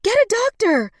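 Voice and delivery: very high, loud urgent command; frantic shout. A narrator's reading. Woman sending Henry for a doctor, screaming high. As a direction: scream for help to be fetched. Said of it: "she shouted".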